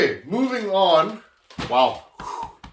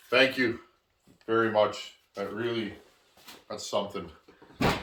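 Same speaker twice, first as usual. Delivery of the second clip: sincerely moved, soft and low, trailing off; quiet, halting thanks from someone truly touched; getting emotional; 5 semitones lower, 4 dB quieter than usual. Real, not acted. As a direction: let the gratitude sink into a low, soft voice. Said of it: "I'm getting emotional".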